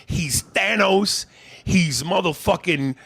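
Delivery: slow emphatic rant. Real, not acted. Building rant about Andy.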